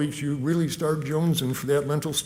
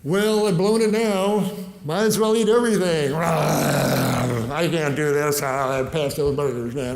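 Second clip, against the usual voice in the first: defeated binge talk; 5 dB louder than usual. Pretend. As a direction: throw up your hands, giving in. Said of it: "One thing you don't do is say".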